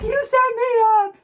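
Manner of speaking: high, loud, drawn-out wail; re-enacted outraged cry; accusing yell. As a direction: yell it like a betrayed victim. Retold crying accusation of mother-in-law.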